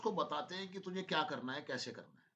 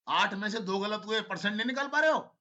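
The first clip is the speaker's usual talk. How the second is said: scolding teacher; 4 semitones higher, 8 dB louder than usual. Real, not acted. Teacher scolding students over maths.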